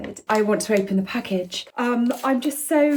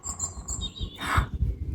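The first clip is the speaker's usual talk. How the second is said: sudden gasp; 7 dB quieter than usual. Real, not acted. Hearing a woodpecker.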